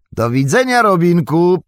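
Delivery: high, loud goodbye call; shouted farewell. A narrator's reading. Sam shouts farewell while riding off.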